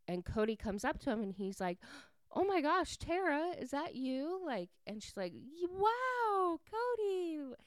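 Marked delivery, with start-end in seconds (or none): 2.3-4.4 high-pitched, loud opening burst of recognition; imitating an astonished hello; surprised greeting. Acted surprise at running into her. 5.3-7.5 very high, drawn-out mock amazement; badly faked astonishment at a chance meeting; feigned surprise. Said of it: "acting all surprised and everything".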